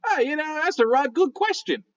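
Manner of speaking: high, squeaky gravelly raccoon voice. Acted. A voice heard from nowhere, squeaky and gravelly. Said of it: "Kind of squeaky and gravelly say".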